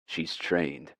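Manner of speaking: usual speaking voice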